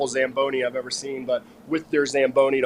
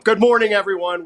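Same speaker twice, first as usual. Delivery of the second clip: loud, higher-pitched morning greeting; loud call-out; 6 semitones higher, 7 dB louder than usual. Real, not acted. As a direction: burst out with a big hello. Drops hush, calls out greeting loudly.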